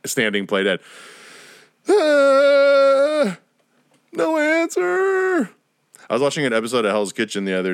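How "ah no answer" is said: very high, loud, strained squeak, drawn out slowly; mock frozen reaction. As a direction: squeal it like a deer in headlights. Frozen festival kid reaction.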